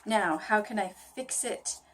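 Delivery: ordinary delivery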